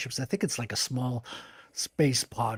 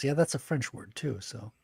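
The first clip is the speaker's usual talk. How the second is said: ordinary talk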